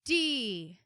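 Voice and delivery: loud, spelled-out, slowing to a drawl; over-the-top acrostic recital; mock acrostic. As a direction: ham up a silly acrostic. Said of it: "waiting for it to be like".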